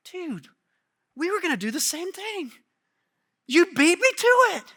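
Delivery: the ten complaining to James and John, jealous; indignant disciple. Retold or imitated. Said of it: "Here's what they're indignant about".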